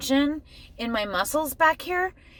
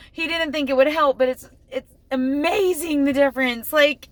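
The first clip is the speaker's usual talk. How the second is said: amazed; 5 semitones higher than usual. Real, not acted. Amazed relief.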